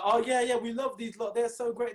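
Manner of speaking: high-pitched, hammed-up fake enthusiasm; mocking gushing school. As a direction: sneer at the school's fake praise in a squeaky high voice. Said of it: "The school was like".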